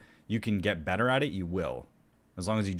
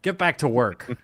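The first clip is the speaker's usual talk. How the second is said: stern taskmaster; 4 semitones higher, 6 dB louder than usual. Pretend. Stern coach voice, mock.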